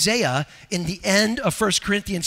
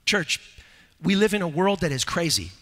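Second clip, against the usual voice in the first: usual speaking voice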